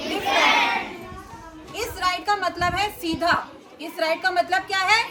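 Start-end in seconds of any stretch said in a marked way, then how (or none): 0.1-1.8 drawn-out answer. Shown as a demonstration. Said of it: "क्या बोलते हैं?".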